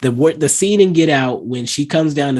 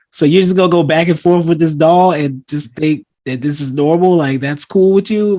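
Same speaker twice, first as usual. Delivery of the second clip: higher, louder pretend disbelief; raised-voice imitation of someone appalled; mock incredulous challenge; 5 semitones higher, 4 dB louder than usual. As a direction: challenge them as if it's absurd. Acting out disbelief at doll.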